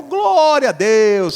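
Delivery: slow, high, loud cry of praise; mock praise. Stranded driver thanks God for the mechanic, slow loud praise. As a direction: stretch it out like a joyful hallelujah.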